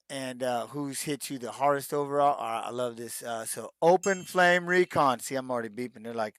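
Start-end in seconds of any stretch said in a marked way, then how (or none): 3.8-5.2 announcer name call. Pretend. Announcing a fan's username.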